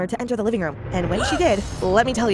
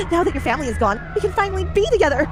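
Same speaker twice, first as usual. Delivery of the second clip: yelling; 8 semitones higher than usual. A narrator's reading. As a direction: shout it triumphant and high. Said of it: "she yelled".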